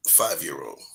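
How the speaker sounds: ordinary talk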